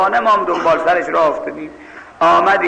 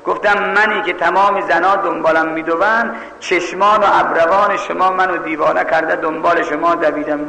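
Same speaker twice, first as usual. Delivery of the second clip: normal talking voice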